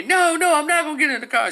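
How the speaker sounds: very high, loud refusal; imitated child's shrieked protest; screaming child. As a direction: scream back like a defiant little girl. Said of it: "screaming and".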